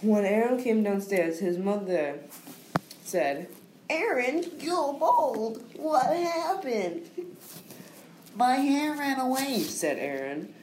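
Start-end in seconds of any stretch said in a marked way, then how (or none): 3.9-7.0 high, slow worried mother. Acted. Mother speaks in the story. 8.4-9.6 high, lightly voiced young boy; little boy voice. Aaron answers his mother.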